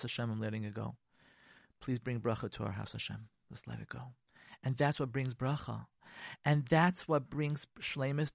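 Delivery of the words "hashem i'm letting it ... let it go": low, hushed, fading away; calm prayer. Acted. Praying for blessing on the house, hushed calm. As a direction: breathe out a soft private plea. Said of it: "Take a couple deep breaths".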